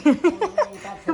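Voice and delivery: laughing squeal. Real, not acted. Child echoes word, laughing.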